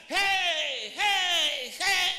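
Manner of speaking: imitated festive yelling; shouting hey. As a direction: whoop like someone dancing at a feast. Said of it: "Algunos hasta gritando".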